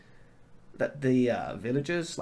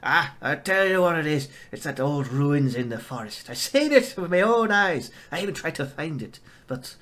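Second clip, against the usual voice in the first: loud, gruff Scottish farmer's rant; old farmer, Scottish; 3 semitones higher, 4 dB louder than usual. Acted. GM voicing the farmer insisting he saw ruins, Scottish accent. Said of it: "The farmer turns around and says".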